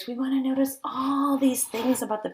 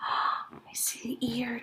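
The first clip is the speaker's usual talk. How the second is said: slow secret whisper; 2 semitones higher, 9 dB quieter, much slower than usual. Acted. Teacher whispers slowly to children about the hidden ear. Hushed discovery.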